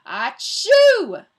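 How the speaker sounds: explosive high, loud "achoo"; hammed-up storybook sneeze; huge acted sneeze. A narrator's reading. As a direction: burst out a drawn-out sneeze. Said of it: "Todd barked".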